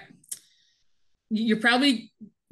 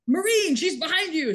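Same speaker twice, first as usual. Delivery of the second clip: mock call out, pitched up; lightly voiced sergeant calling out; drill sergeant call; 7 semitones higher than usual. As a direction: warn a recruit from the sidelines. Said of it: "the drill sergeant's like".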